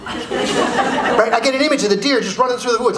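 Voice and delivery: ordinary delivery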